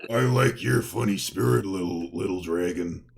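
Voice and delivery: a hint of the loud hoarse Minotaur, fading. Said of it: "your voice was different before".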